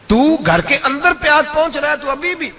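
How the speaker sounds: high retold scolding, falling away at the end; indignant reproach. Caliph telling his wife she has food at home, indignant. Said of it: "حضرت عمر جلال میں آ گئے".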